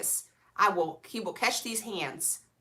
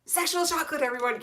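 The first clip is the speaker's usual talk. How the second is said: 1 announcer voice; 8 semitones higher, faster than usual. Retold or imitated. Movie line quoted again.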